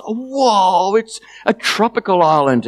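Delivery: high, wowed exclamation; acted wonder. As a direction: marvel like an excited child. Shame marvels at island sighting.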